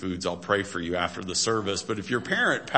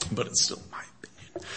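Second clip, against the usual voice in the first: quick, conspiratorial whisper; hushed, hurried joking confession; whispered aside; 6 dB quieter, faster than usual. Real, not acted. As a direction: slip it in under your breath, whispering. Joking aside after a disclaimer.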